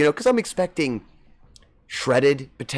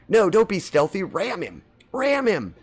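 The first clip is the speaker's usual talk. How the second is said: higher-pitched, imitating an eager plea to ram; urgent egging-on; 7 semitones higher than usual. Viewer begs the streamer to ram a ship, imitated urging. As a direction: act out someone begging for a reckless charge. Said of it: "you begged me to ram something".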